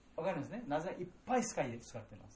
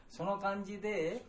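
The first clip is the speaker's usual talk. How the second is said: ordinary delivery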